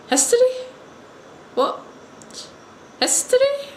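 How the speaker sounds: squeaky, high questioning; puzzled. Retold or imitated. Japanese teacher not understanding the visitor's word, acted puzzlement. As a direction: mimic bafflement with the voice shooting up.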